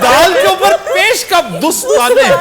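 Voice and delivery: ऊँची, ज़ोरदार हैरानी; चौंक कर, खींच कर पूछो; हैरान. Real, not acted. Shocked at the word. (हैरानी भरी ऊँची आवाज़)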